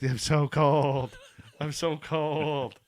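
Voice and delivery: slow, bleating goat voice. Retold or imitated. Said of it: "even used the goat voice".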